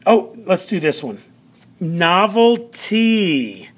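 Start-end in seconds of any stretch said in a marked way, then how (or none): 1.8-3.7 "Novelty" drawn out slowly; teacher stretching out "Novelty" for learners; sounded-out word. Shown as a demonstration. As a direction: say "Novelty" slowly with a raised, long final "tee". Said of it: "I want you to listen to that".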